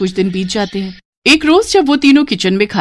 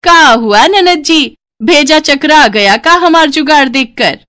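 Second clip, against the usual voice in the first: high, loud, slow gloat; taunting swagger; 7 semitones higher, 5 dB louder than usual. Acted. Bride gloats to her sister-in-law with swagger. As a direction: rub it in her face. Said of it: "टशन मारते हुए".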